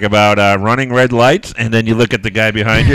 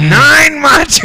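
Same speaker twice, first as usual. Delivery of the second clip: excited; 14 semitones higher, 3 dB louder, much slower than usual. Real, not acted. Scoring the beatbox contest.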